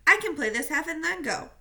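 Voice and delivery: captain begging to stay in the game; protesting. Acted. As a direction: insist hard on playing. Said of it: "Victor continued to protest".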